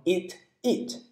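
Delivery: "eat it" as two separate words; "eat it" with a gap and no linking; unlinked words. Shown as a demonstration. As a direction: say "eat", then "it", each on its own. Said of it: "可以读成eat it".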